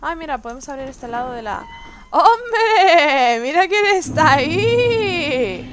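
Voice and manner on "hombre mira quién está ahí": loud, slow sarcastic hello; mock friendly greeting. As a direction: greet the enemy like a long-lost friend. Greets enemy like an old friend. (saludo burlón y exagerado)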